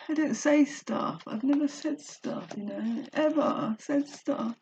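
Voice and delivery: high-pitched, emotional confession near crying; close to tears. Real, not acted. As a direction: speak on the verge of real tears. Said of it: "Oh, I wanna cry".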